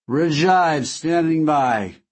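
put-on slow, slurred drunken drawl; town drunk. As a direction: slur it out, heavily drugged. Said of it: "he said drunkenly, slurring his words".

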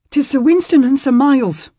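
breathless, loud and high; urgent panting report. Acted. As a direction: gasp out the bad news. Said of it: "the man said breathlessly".

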